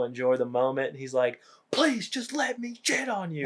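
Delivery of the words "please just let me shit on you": high-pitched mock begging; put-on pleading, pitched way up. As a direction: whine it like a desperate plea. Begging partner in retold sketch.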